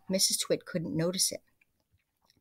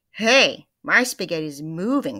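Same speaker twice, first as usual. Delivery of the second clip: loud, startled yell; alarmed cry; 9 dB louder than usual. Acted. Mister Twit discovers his moving spaghetti, loud startled cry. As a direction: shout it like your dinner just moved. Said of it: "cried Mister Twit".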